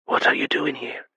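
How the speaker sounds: hissed whisper through clenched teeth; urgent unvoiced whisper through teeth. A narrator's reading. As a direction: whisper it so no one hears. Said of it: "she whispered through her teeth".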